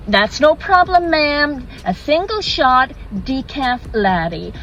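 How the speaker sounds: high American barista, drawing out the order; American accent. Acted. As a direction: reassure brightly in an American accent. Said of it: "Sorry, forgive the accent".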